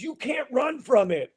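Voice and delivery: Carl's gruff scolding. Retold or imitated. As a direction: playfully imitate the mentor snapping at a kid. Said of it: "he goes".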